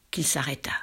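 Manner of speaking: ordinary delivery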